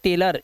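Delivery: टेलर with a schwa ending; correct pronunciation. Shown as a demonstration. Teacher answering own question, टेलर ending in "-ler". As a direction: say टेलर as "tay-lur". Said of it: "क्या पढ़ते हैं".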